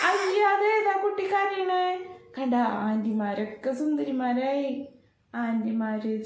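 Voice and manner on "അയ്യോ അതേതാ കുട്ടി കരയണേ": mock pitying coo. Mock pity for crying baby.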